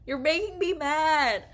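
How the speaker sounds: high, loud whiny complaint; frustrated outburst. Real, not acted. Gamer yelling at the game choice, frustrated and whiny. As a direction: complain loudly at the screen.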